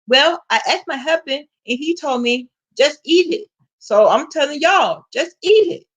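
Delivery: playful Filipino accent impression, pushing the food; coworker's Filipino accent. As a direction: mimic her Filipino accent, insisting everyone eat. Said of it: "you have to be able to catch the accent".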